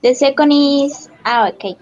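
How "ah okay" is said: ordinary talk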